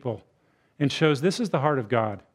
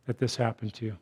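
soft, tender consolation; gentle, softer sympathy for someone in grief; comforting; 5 semitones lower, 4 dB quieter than usual. Shown as a demonstration. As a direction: speak it like an arm round a grieving friend. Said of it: "put your arm around them and say".

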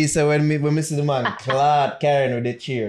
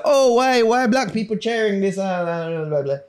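mock outraged onlooker; 6 semitones higher than usual. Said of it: "people them were probably".

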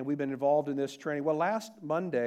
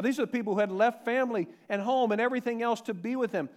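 ordinary delivery

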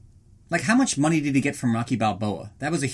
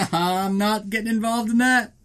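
higher-pitched, laughing as he bows out; laughing refusal; 6 semitones higher than usual. Retold or imitated. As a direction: laugh it off and stay out of it. Acted refusal to help, with laugh.

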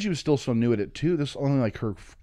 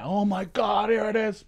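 mock amazed revelation; 9 semitones higher than usual. Telling of breaking through that wall, high mock amazement. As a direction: act out a sudden revelation. Reaching a breakthrough in a retold trip.

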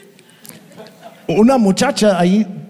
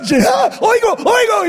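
yelling that he can hear; excited shout. Retold or imitated. Healed man shouting to the pastor in joy.

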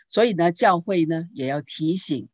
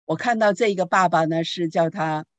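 usual speaking voice

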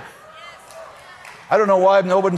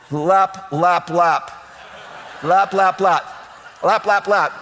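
praying men lap; 4 semitones lower, much slower than usual. Retold or imitated. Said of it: "Gideon's praying".

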